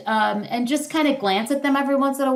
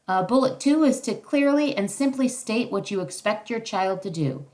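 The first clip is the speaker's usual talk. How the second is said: ordinary talk, nothing marked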